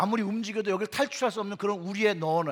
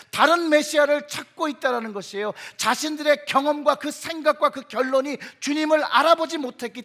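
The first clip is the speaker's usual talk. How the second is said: loud, raised, emphatic sermon delivery; preaching at full force; 6 semitones higher, 8 dB louder than usual. Real, not acted. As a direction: drive each point home to the congregation. (힘주어 외치는 설교조)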